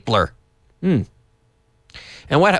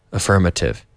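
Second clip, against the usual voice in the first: ordinary talk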